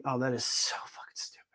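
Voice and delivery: disgusted under-the-breath whisper; whispered aside. Real, not acted. Reacting to the fine, a disgusted whisper. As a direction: hiss it to yourself in disbelief.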